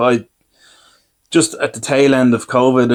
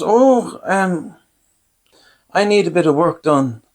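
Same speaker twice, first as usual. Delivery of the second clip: higher-pitched, hesitant quote of the dentist; angling dentist; 6 semitones higher, slower than usual. Retold or imitated. Dentist sounding out her electrician patient, higher retold voice. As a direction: pitch it up, feeling out a favour.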